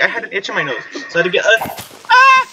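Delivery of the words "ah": short, loud, high shriek; startled scream. Real, not acted. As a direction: yelp mid-sentence. Startled mid-explanation.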